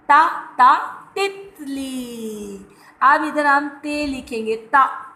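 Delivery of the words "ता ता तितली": ता, ता, then तितली drawn out slowly; sound out the letter. Shown as a demonstration. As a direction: stretch तितली slowly after a sharp ता ता. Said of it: "किसकी आवाज़ आ रही है?". (अक्षर की आवाज़ पर ज़ोर देकर धीरे से तितली)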